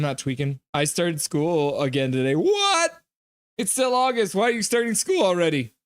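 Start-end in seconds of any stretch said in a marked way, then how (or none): 2.3-5.7 high, incredulous outburst; surprised disbelief. Real, not acted. Streamer reacting to a viewer starting school, incredulous. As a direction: sound genuinely shocked it's so early.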